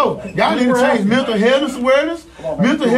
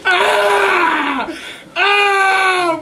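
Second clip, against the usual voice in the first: crying out; 9 semitones higher, much slower than usual. Real, not acted. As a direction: sob and shout in pain. Man crying out in pain.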